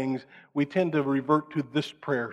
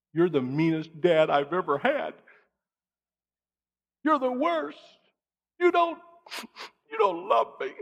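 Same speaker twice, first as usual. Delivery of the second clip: put-on high, rising whiny child voice; a child complaining to dad, high and whiny; 9 semitones higher than usual. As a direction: pout it like a kid scolding dad. Child protests discipline.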